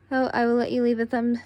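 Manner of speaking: usual speaking voice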